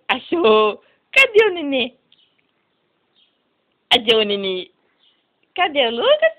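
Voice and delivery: loud sing-song peekaboo. Real, not acted. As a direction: play peekaboo, bright and loud. Peekaboo with baby Lucas. (esconde-achou com o bebê)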